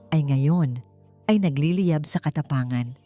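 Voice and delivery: usual speaking voice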